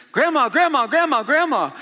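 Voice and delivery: high, loud, excited grandson calling out; imitated child's yell. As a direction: yell it like a little boy running in to find his grandmother. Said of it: "he yells".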